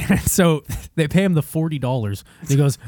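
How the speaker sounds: ordinary talk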